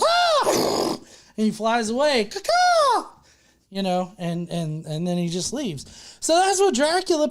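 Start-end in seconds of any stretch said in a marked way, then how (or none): 0.0-0.9 very high sudden shriek; high-pitched squawk of fright; mock startled scream. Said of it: "he gets scared and he goes". 2.2-3.2 high squawking caw-caw with a laugh; high laughing bird call; imitated parrot call. Parrot flying away.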